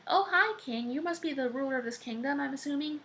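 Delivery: high-pitched, hammed-up hello; perky greeting. Jack meeting the giant king, high perky greeting.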